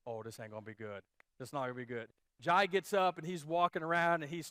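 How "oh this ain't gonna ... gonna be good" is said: low, soft foreboding to himself; hushed, low seasick resignation; queasy dread. Retold or imitated. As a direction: say it quietly, sinking into dread.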